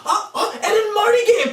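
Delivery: excited. Acted. Excited kid spotting a game.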